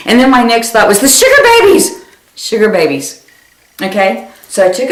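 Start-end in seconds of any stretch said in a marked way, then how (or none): 1.0-2.0 high, loud sudden cry; excited realization. Retold or imitated. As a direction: blurt it out, suddenly thrilled.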